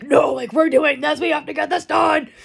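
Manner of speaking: mock-possessed determined outburst; possessed insistence. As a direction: insist like something took over you. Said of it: "a demonic possession takes over me".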